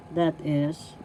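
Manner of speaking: ordinary talk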